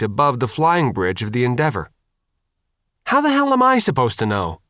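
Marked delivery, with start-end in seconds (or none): 3.1-4.6 high-pitched, exasperated retort. A narrator's reading. Faith, pressed again for a way aboard, exasperated. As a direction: throw the question back, pitched high. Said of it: "Faith repeated".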